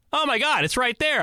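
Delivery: amazed discovery. Retold or imitated. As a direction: point and gasp it out. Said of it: "and they go".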